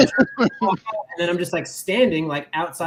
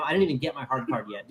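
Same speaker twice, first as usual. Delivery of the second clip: ordinary talk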